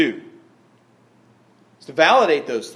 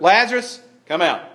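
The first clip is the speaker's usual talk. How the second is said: high, loud call, then a firm drop; authoritative yell; 4 semitones higher, 4 dB louder than usual. Acted. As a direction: call him out with full authority. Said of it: "authoritative yell".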